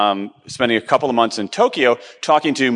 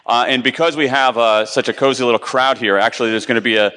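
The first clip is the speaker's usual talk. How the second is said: ordinary delivery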